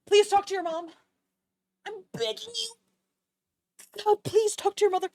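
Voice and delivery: high-pitched, hammed-up begging; mock pleading.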